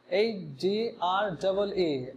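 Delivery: letter by letter, slowly; slow spelling. Shown as a demonstration. Teacher spells agree slowly for students. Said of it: "agree".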